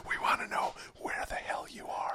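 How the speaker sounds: demanding whisper. Acted. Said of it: "You gotta whisper".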